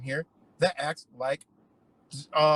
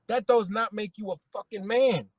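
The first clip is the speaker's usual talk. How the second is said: ordinary delivery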